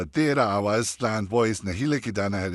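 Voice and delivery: normal talking voice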